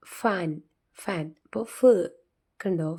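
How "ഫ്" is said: "ഫ്" as f plus short uh, louder and higher; teacher stressing the f sound in "ഫ്"; stressed sound demo. Shown as a demonstration. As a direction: punch out the lone sound "ഫ്". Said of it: "ഫ് എന്നാണ്".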